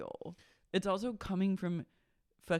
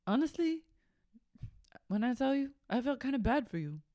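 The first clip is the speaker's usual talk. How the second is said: high, mock-pitying voice; mock sympathetic [name]; 7 semitones higher than usual. [name] telling Joe she felt bad, high and mock pitying. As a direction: coo it in a high pity voice.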